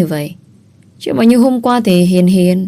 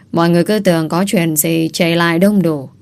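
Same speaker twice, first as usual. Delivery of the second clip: ordinary delivery, nothing marked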